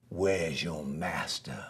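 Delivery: low, slow, wary growl of a question; a hungry gator checking for danger. Acted. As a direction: drag it out low and suspicious. Gator asks warily.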